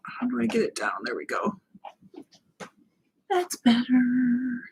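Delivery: real whisper while fixing the light; whispering to herself. Real, not acted. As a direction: whisper it under your breath as you fiddle with the light. Fiddling with the light settings.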